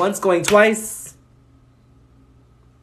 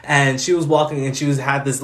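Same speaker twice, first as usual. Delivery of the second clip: ordinary talk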